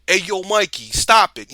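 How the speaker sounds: loud, high New York tough-guy voice; imitating a tough-acting Raphael with a thick New York accent; heavy New York accent. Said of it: "that heavy New York accent".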